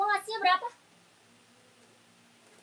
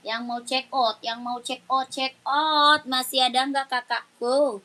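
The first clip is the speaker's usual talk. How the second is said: loud, brisk live-stream seller pitch; live sales call; 12 dB louder than usual. Acted. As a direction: hustle viewers to comment before the break. Live seller calling viewers. (seruan penjual live yang lantang)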